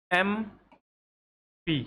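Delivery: एमपी said slowly, letter by letter as 'em-pee'; एमपी stretched out slowly as two letters; correct pronunciation. Shown as a demonstration. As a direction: draw out एमपी, starting on an 'e' vowel. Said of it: "यही तो कहते हो इसको".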